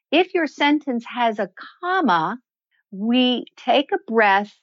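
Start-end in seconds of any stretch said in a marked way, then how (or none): 0.1-2.4 "comma" lifts to signal more is coming; comma inflection. Shown as a demonstration. Voice coach shows a comma with "comma" inflected upward. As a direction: hang the phrase open on a rising "comma". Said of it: "we inflect our voice at that comma".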